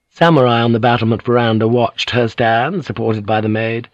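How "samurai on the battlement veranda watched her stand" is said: ordinary delivery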